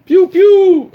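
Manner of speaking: pew pew, high laser shots; squeaky blaster noises; high pew pew sound effect. Acted.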